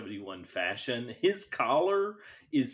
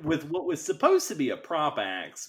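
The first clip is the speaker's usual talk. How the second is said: ordinary talk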